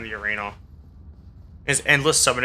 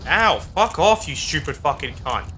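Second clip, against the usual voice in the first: swearing tirade; 2 semitones higher than usual. Real, not acted. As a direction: shout the curses, then keep spitting them. Hit by enemy, swears at it.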